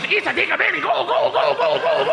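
high cartoon-kid chant; Dora impression. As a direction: cheer it like a cartoon explorer. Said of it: "That's what she's".

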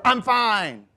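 high, loud snappish insistence; passive-aggressive denial. Acted. Person hiding anger from others, snappish denial. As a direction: act clearly not fine, loudly. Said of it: "But that's passive-aggressive".

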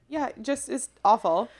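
normal talking voice

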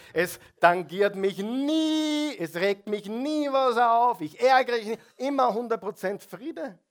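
a hammed-up show of total inner peace; mock perfect calm. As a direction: ham up the flawless saint. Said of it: "Wer hat immer nur friedliche Gedanken".

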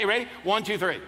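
ordinary talk

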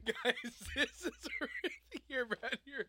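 slow laughing, near crying; laughing to tears. Real, not acted.

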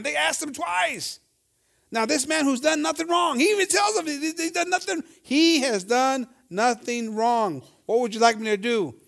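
high, loud, impassioned preaching; preaching at full force. Acted. Preacher pressing the congregation, loud impassioned preaching. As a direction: preach it hard to the church.

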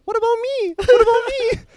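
high, loud mocking whine, breaking into laughter; mocking falsetto. Mocking the pink-haired woman on his way out, high taunting whine. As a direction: taunt her in a shrill fake whine while laughing. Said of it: "when I was leaving, I was like".

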